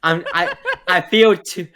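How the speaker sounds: talking through laughter; laughing. Real, not acted. Friend admitting he can't just say no, laughing. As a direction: laugh while trying to explain.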